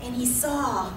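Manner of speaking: ordinary delivery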